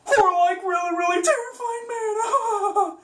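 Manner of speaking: cartoon terror, voice cracking up high; terrified Shaggy. Shown as a demonstration. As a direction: break up into a scared whine. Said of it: "get that very high pitch".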